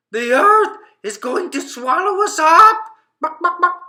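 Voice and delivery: high, loud panicked chicken cry; alarmed calling out. Acted. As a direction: shout the warning to the whole street. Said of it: "he called out".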